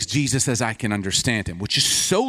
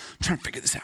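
whispered aside, puzzling it out; softer whispered self-talk; whispered to himself; 3 semitones higher, 6 dB quieter, much faster than usual. Acted. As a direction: whisper it as if thinking aloud. Preacher muttering to himself.

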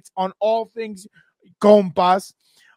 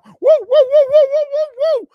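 much higher, louder wrestler woos; Nature Boy woo; 8 dB louder than usual. Retold or imitated. Host channeling the Nature Boy to hype the show, high shrieking woos. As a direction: let loose strutting Ric-Flair-style woos. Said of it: "Feel like the nature boy right now".

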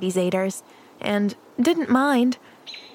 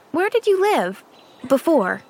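bright, higher curious question; 7 semitones higher, 3 dB louder than usual. Acted. Pollyanna quizzes the sullen boy, high and curious. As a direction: ask eagerly, lifting up high. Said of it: "she queried".

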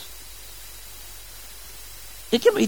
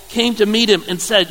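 ordinary talk

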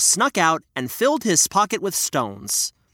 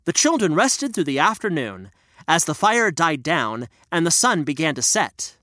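ordinary delivery, nothing marked

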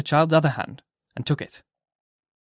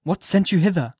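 higher, searching question; the minister's voice; 5 semitones higher than usual. Acted. Minister questioning Hester, higher and searching. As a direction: ask what brought her.

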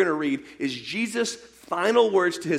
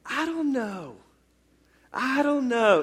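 higher, unsure mumbled doubt; doubting disciples; 6 semitones higher, slower than usual. Retold or imitated. Preacher mimics doubting disciples: higher unsure mumble. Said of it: "just sitting there saying".

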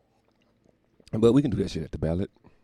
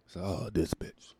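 ordinary delivery

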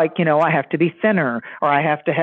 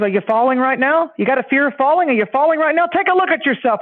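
higher, insistent retold questioning; mock exasperated challenge; 7 semitones higher than usual. Skit psychologist challenging a patient afraid of falling, insistent. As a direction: press the patient with raised pitch.

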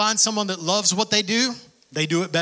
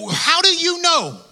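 exasperated; 11 semitones higher, 4 dB louder, slower than usual. Real, not acted. Preacher's exasperated retort.

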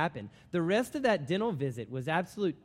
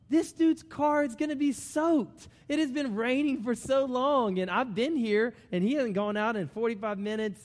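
higher-pitched, incredulous inner voice; alarmed realization; 7 semitones higher than usual. Acted. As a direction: act out a worried first thought, pitched up. Acts out his alarmed first thought.

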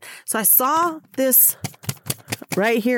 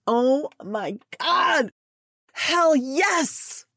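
excited; 5 semitones higher, much slower than usual. Real, not acted. Celebrating finished website.

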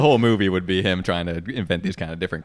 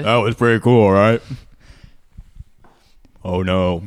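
deep gas voice; 2 semitones higher, 9 dB louder, slower than usual. Real, not acted. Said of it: "I have my own anti-helium gas".

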